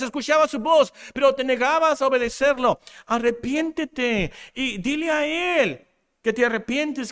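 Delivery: high, pressing altar-call voice; urgent preaching. Real, not acted. Preacher calls the congregation to repent, urgent preaching. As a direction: plead with them to repent, insistently.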